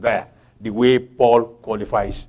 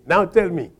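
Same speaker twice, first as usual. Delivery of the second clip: ordinary talk, nothing marked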